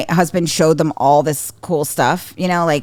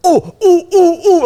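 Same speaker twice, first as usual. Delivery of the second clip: high, loud bursts begging to be picked; eager kid's "ooh ooh"; 12 semitones higher, 6 dB louder, slower than usual. Retold or imitated. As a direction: mimic a child straining with a raised hand. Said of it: "He goes".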